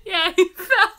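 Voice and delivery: laughing through the words; cracking up as she says it. Real, not acted. Laughing at brother's fall.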